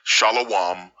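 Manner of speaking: "shalawam" as sha-la-WAHM; "shalawam" with the wrong -wahm ending; mock mispronunciation. Shown as a demonstration. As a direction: say "shalawam" in three syllables with a w. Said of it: "there has never been any such word".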